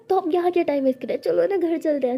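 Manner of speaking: quick excited plea to go home; eager rush. Acted. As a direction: hurry the words out, eager.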